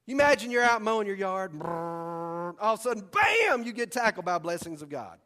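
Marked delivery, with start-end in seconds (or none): 1.5-2.6 mock engine brrr with lips; playful lawnmower rumble; lawnmower noise. Imitating lawnmower while mowing yard. 2.6-3.6 high, loud shouted bam; mock impact shout; sudden bam. Sudden tackle by blessings.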